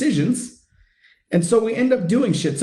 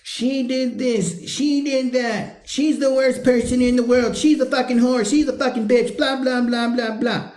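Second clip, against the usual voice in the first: higher, droning put-on whine; mock complaining; 6 semitones higher than usual. Coach imitating husbands complaining about wives, mock whiny. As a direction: rattle off grievances in a higher mocking voice. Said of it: "Complaining as a coping mechanism".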